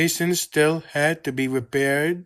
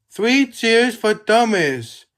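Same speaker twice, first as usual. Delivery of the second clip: cheerful call for cheers; 7 semitones higher, 5 dB louder than usual. A narrator's reading. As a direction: lead the crowd's cheer. Leading cheers.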